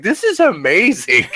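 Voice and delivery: quoting friends in awe; amazed friends. Retold or imitated. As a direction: act out a friend blown away by the game.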